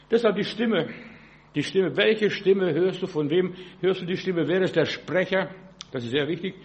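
ordinary talk

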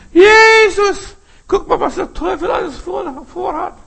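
high, loud cry for help; frightened plea. Pretend. Threatened believer crying to Jesus, loud plea. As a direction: call out to Jesus under threat. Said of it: "bedroht wirst".

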